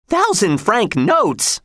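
high, slow exclamation of amazement; astonished cry. A narrator's reading. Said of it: "she cried".

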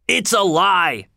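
high, slow, furious denial; a caught man shouting his denial; angry shout. Acted. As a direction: fling the accusation back, outraged. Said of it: "he shouted".

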